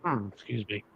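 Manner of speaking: coughing and choking, quiet voice; choking on coffee, half-whispered apology; choking on a drink. Real, not acted. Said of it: "sorry, I'm choking".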